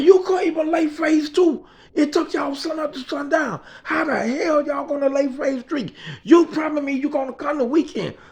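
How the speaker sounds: high-pitched, exasperated scolding; berating. Retold or imitated. Man berating the boss man over delays, exasperated. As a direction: tear into a crew that fell behind.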